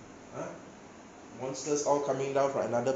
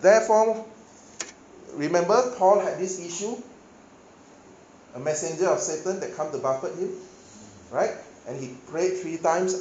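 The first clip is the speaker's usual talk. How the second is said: full-force preaching; 4 semitones higher, 7 dB louder than usual. Acted. Preacher drives Paul's verses home to congregation, loud preaching.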